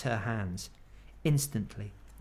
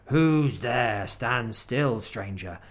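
growling wolf; 4 dB louder, much slower than usual. Acted. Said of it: "said in a growling voice".

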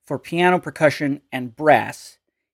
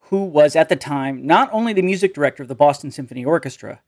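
usual speaking voice

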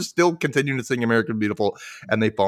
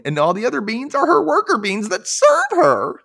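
higher, louder cartoon explanation; Doctor Stupid voice; 13 semitones higher, 5 dB louder than usual. Doctor Stupid explaining beans, higher. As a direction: proclaim it like a silly expert. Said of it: "He says".

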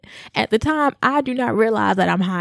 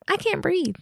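high, softer mock-panicked squeak; retold breathless panic; 12 semitones higher, 4 dB quieter than usual. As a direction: squeak it out, reliving the panic through laughter. Retelling her panic at being high.

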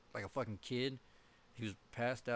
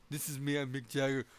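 higher and louder, cocky, bouncy rock star; 5 semitones higher, 7 dB louder than usual. Retold or imitated. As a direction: strut it like a pumped-up frontman. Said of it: "he's like".

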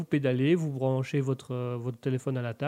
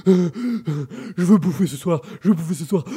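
out of breath; 2 semitones higher, 5 dB louder, slower than usual. Pretend. Obese man rowing for Big Mac.

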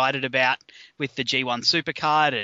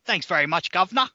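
mock English accent; 3 semitones higher than usual. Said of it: "do you like my English accent".

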